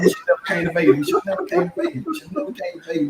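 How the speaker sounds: high, fast, frantic babbling; hammed-up frantic babble; panicked repetition. Repeating himself in panic.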